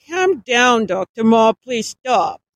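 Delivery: firm, loud plea to stop; steady her sternly yet kindly; stern but sympathetic. A narrator's reading. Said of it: "Alan spoke in a stern, sympathetic tone".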